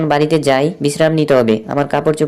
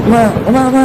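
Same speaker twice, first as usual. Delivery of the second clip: higher, quick yelp of alarm; sudden high exclamations; startled cry; 9 semitones higher, 4 dB louder than usual. Acted. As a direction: cry out as something nearly hits you. Startled by near miss in rain.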